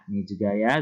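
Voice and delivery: normal talking voice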